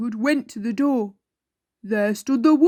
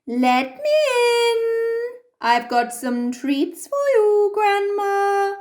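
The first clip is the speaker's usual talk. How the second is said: wolf pretending; 9 semitones higher than usual. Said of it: "said the wolf".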